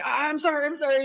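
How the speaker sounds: high, hurried sorry; mock sheepish teen apology. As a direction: apologise like a caught kid.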